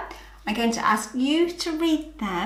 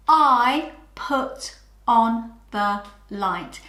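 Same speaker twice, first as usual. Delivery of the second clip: each word slow and clear; slow model sentence; much slower than usual. Shown as a demonstration. Teacher modelling the sentence slowly for children. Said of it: "So my sentence is".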